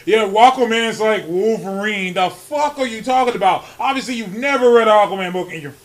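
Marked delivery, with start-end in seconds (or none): none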